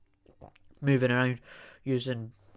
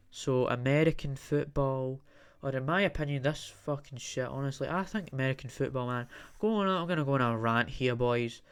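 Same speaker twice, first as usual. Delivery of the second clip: ordinary talk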